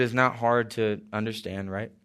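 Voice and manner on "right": ordinary talk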